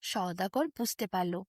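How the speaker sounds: ordinary delivery, nothing marked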